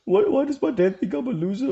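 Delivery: high, whiny self-pity; in my feels. Retold or imitated. Son whining about his dad, high and self-pitying. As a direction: whimper it like a wounded kid. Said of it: "in my feels like".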